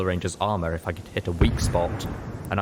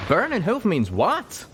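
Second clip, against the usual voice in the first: higher, slow confused question; puzzled character voice; 12 semitones higher, 4 dB louder, slower than usual. A narrator's reading. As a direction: puzzle over it out loud.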